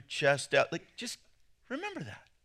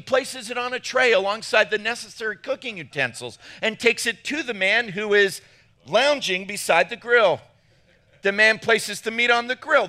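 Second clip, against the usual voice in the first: raised, emphatic read-aloud voice; 4 semitones higher than usual. Acted. Pastor reading a barbecue joke to the room, emphatic reading aloud. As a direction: read each step out for comic effect.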